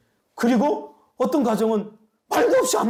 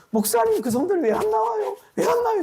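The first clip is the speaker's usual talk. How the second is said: much higher, fretful elder asking the pastor; worried elder's voice. Retold or imitated. Elder pestering the pastor about absent members, in a high, fretful voice. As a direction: play a fussing elder checking on absentees.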